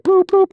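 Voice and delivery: high beeping noise; imitated beep. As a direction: chirp it like a machine beep. Imitating call-center beep.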